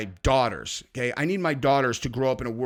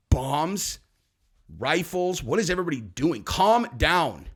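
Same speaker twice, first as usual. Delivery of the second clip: usual speaking voice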